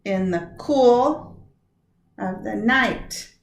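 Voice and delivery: slow, "cool" and "night" stressed; scanning stresses. Shown as a demonstration. Teacher slowly marking stresses on the last line. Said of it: "that probably means".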